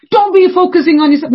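put-on high, loud scolding of a child; nagging parent. Parent scolding the kids, high and loud. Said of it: "Then they'll go after their kids".

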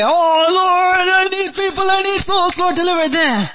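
high, loud mock-tearful wail of prayer; crying out to God. Preacher models crying to God for souls, high pleading wail. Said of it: "Learn to be a good crybaby".

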